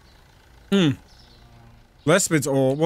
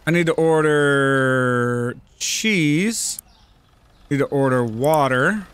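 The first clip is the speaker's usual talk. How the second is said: slow drawn-out musing; 5 semitones lower, much slower than usual. Real, not acted.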